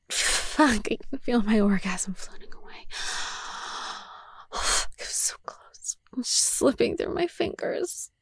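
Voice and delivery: strained, fading whisper; frustrated whisper. Acted. Telling her partner it's slipping away, whispering. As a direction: let it slip away on the breath.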